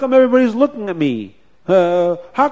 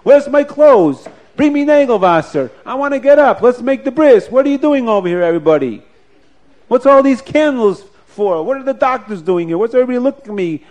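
higher and louder, bursting with energy; eager revived father; 6 semitones higher, 6 dB louder than usual. Acted. Father just woken in bed demands his clothes for the bris: higher, louder, eager.

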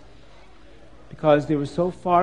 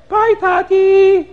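high, drawn-out kiddie voice; children's goodbye; 7 dB louder, slower than usual. Acted. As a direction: call out goodbye like a little child, very high and loud. Children waving father goodbye.

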